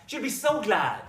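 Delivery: bubbling delight. Acted. Husband thrilled for his aunt, bubbling over. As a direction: burst out happily on "so glad". Husband repeats it, more excited.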